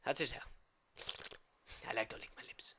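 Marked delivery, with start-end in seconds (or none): 1.9-2.7 whisper. Acted. Creepy aside.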